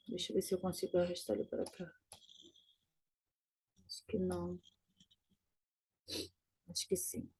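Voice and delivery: very soft, low private mumble; talking to oneself. Real, not acted. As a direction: think aloud under your breath. Murmura para si mexendo na tela. (falando baixinho consigo mesmo)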